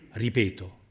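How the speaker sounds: ordinary delivery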